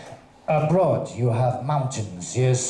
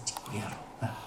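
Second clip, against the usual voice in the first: breathy seductive whisper of te quiero; 6 semitones lower, 17 dB quieter, much faster than usual. Shown as a demonstration. Comic to the audience, whispering te quiero seductively. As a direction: whisper "Te quiero" softly, unvoiced, like a lover. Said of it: "whispered repeat".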